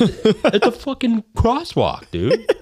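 loud, high, drawn-out mock outrage; exasperated disbelief. Hunter rants about crosswalk flags, high-pitched exasperation. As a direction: stretch it out, squeaking with incredulity.

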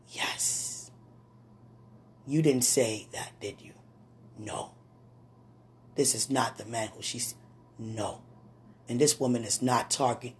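soft, low prayer with whispered answers; hushed prayer. Acted. Preacher asking God about her daughter, hushed prayer. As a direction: pray it quietly, breath for the replies.

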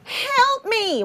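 put-on high whimpering kid voice; mock toddler whine; whiny kid plea. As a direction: imitate a little boy begging for help. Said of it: "and go".

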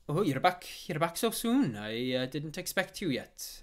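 blacksmith caught off guard, high and loud; high-pitched, loud welcome back; pleasant surprise. Acted. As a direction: exclaim in delighted surprise at an unexpected return. Blacksmith greets returning player.

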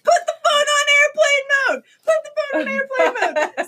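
high-pitched frantic order; urgent, strained high cry; panicking. Retold or imitated. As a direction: imitate a panicked partner yelling instructions. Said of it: "we're just, like, panicking".

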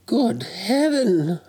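put-on high, slow, wondering old man; awed Scrooge. A narrator's reading. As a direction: marvel slowly in Scrooge's voice. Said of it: "said Scrooge".

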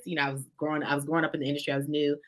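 ordinary delivery

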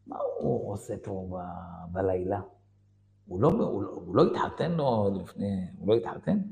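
low, soft, hesitant question; שאלה חשאית. Retold or imitated. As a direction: ask a friend discreetly, keeping it low. שאלה חשאית לחבר בישיבה. (שאלה בשקט ובהיסוס)

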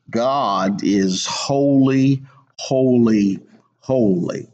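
slow, stretched "God is holy", then each "holy" set apart; solemn, spaced-out stress on every "holy"; emphatic repetition. Retold or imitated. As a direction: draw out the first "holy", pause between the rest. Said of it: "in order for him to emphasize".

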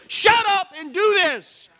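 high, loud, slow blunt command; blunt order. Retold or imitated. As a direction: tell them straight to do it. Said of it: "compassion you have is go".

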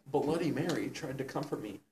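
ordinary talk

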